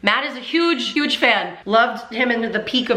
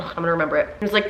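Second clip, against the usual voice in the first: ordinary delivery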